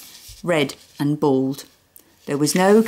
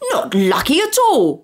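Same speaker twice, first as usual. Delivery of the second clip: high, furious roar; 8 semitones higher, 3 dB louder than usual. Acted. Angry neighbour roaring at Dad, furious. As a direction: roar the correction. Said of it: "roared Mister Tugg".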